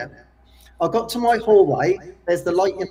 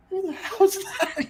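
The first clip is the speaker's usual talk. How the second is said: high, slow, startled "who the hell's that"; mock the parents' surprise at a late doorbell; startled parents; 13 semitones higher, slower than usual. Parents startled by a late visitor, high and slow. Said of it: "and they go".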